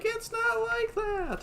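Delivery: very high, drawn-out protesting squeal; hammed-up embarrassed wail; mock flustered denial. Retelling the pair denying they're a married couple, mock flustered denial.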